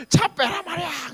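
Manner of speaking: high-pitched yelled order; 소리 지르는 직원. Retold or imitated. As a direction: imitate a worker yelling at a driver. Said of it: "소리를 지르는 것 같아요". (높은 소리로 호통치기)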